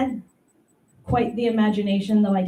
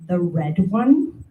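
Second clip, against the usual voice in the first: slow, mock-innocent question; teasing reply; 2 semitones lower, much slower than usual. Teasing sister about the ball, slow mock innocence. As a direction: drag it out to needle her. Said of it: "I'm like".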